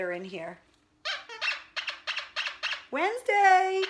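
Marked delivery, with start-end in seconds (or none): 2.9-3.8 loud high call to the dog. Real, not acted. Owner calling her dog from the next room, loud and high. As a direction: call her name across the house.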